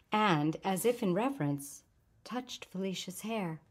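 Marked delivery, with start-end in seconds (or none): none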